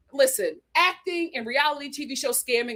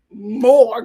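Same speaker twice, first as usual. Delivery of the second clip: more punched loud and held; stress on more; 10 dB louder than usual. Real, not acted. Host coaching Becca to give more. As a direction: hit the word more hard. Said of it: "emphatic coaching".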